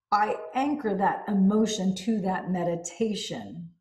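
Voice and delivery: usual speaking voice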